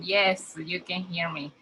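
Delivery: ordinary talk, nothing marked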